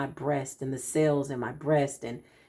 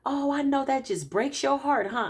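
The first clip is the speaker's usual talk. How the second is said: higher, mock-pitying coo; sympathetic friend quote; 8 semitones higher than usual. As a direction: imitate a friend offering pity, higher. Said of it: "somebody says".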